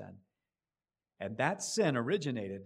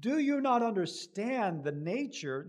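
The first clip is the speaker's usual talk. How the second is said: pressing, accusing question; indignant rebuke; 9 semitones higher, 5 dB louder than usual. Acted. King confronting Abraham, accusing. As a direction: demand he grasp the harm. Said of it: "This is what Abimelech is saying to him".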